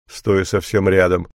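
ordinary delivery

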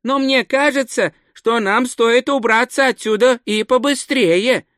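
высокий голос наивного туриста; говори заметно выше, простодушно и старательно; простодушный турист. A narrator's reading. (высоким голосом наивного туриста)

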